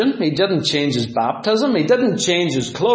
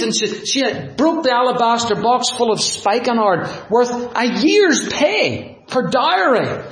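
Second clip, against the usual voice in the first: usual speaking voice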